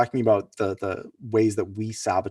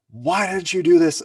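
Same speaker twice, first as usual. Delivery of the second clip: higher, louder mock demand; imitating a fuming partner; angry partner; 8 semitones higher, 7 dB louder than usual. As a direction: storm in demanding an answer.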